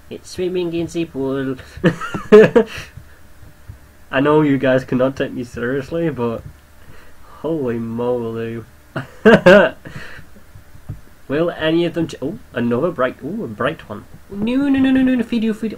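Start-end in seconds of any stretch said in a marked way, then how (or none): 1.6-3.0 loud, high burst of laughter; helpless loud giggle; real laugh. Real, not acted. Laughs at own silly commentary. 8.9-11.2 loud, high laugh that tails off; sudden loud cackle of amusement; real laugh. Real, not acted. Laughs at the odd creatures. 14.3-15.8 high, loud string of no's; mild comic panic, pitched up; panicked pleading. Real, not acted. Panics as creature is dying.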